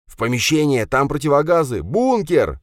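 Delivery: high, loud panicked yelling. Acted. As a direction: yell in panic and run. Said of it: "запаниковал Кравчук". (Кравчук в панике кричит всем бежать в бункер)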